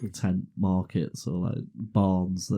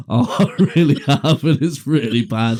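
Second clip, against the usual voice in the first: loud, higher, breaking into laughter; laughing confession; 5 semitones higher, 8 dB louder than usual. Retold or imitated. Son confessing the accident to mum, laughing loudly. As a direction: blurt out the admission through laughs.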